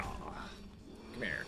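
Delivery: a soft coaxing whisper. Real, not acted. Calling the hamster closer in a hushed whisper. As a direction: whisper to coax the hamster over.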